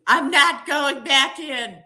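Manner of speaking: defiant. Real, not acted. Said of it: "I was prepared to sleep in my Jeep".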